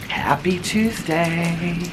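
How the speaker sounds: stretched, lingering hello; slow drawn-out greeting. Acted. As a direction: draw out the greeting slowly.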